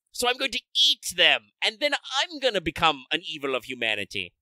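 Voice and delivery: high, hammy gloating villain; sneering panto villain. Retold or imitated. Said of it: "he's just like".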